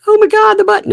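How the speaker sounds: loud, high pretend alarm; high, hammed-up panicked cry; mock panic. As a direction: yelp in fake panic. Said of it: "No, I'm just kidding".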